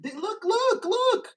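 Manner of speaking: high, loud, rising mock excitement; mock excited pointing. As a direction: imitate someone eagerly pointing at a photo. Mimicking someone showing a picture.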